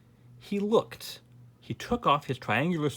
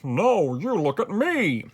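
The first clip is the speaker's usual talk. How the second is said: loud, high, drawn-out royal boast; king answering; 6 semitones higher, 6 dB louder, slower than usual. A narrator's reading. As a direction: show off grandly, stretching the words. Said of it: "answered the king".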